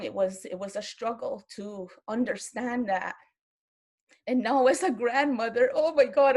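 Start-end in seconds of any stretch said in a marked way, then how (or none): 4.3-6.4 getting emotional. Real, not acted. Speaker overcome while talking of being a grandmother, emotional. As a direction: voice rising, louder as emotion wells up. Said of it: "I'm getting so emotional".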